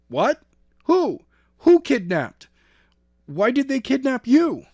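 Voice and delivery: high, loud shocked questions; alarmed. A narrator's reading. Skylar hearing of the kidnapping, high and loud.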